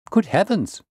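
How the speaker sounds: high-pitched exclamation of shock; astonished cry. A narrator's reading. Patient hears what the case reveals, astonished cry. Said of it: "cried my patient".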